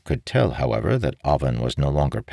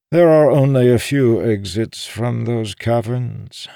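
higher, louder character voice; pitched-up captive making his case; old schemer explaining; 9 semitones higher, 5 dB louder than usual. Acted. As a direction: lay out the facts in a raised old man's voice. Voices Balanmar explaining the caverns.

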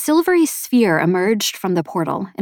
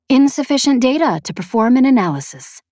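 higher-pitched feminine AI; emphatic machine report, pitched up; feminine sphere voice; 5 semitones higher, 4 dB louder than usual. A narrator's reading. Sphere answers its maker, higher feminine AI voice. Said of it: "the sphere said".

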